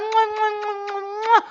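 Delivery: high-pitched "mwah" kisses; a string of squealed air kisses; blown kisses. Real, not acted. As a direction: smooch noises in a high voice. Blowing kisses to arriving friend.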